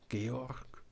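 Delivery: leise gemurmelter Name; murmeln. A narrator's reading. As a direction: den Namen leise vor sich hin murmeln. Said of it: "murmelte Selma". (gemurmelt, kaum hörbar)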